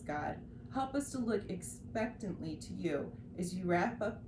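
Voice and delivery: ordinary talk, nothing marked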